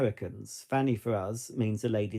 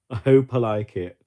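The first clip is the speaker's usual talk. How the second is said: I hope I like it said much louder; a louder, eager echo of I hope I like it; louder emphatic repeat; 10 dB louder than usual. Real, not acted. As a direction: push the repeat out loud and eager.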